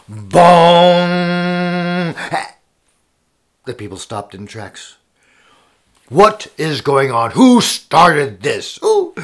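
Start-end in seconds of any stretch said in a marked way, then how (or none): 0.1-2.2 loud ringing, long-held bong; gong strike. Acted. Storyteller imitates the royal gong, long resonant bong. 6.1-9.1 loud, slowing royal interrogation; demanding king. Acted. King questions the stopped crowd, loud and demanding.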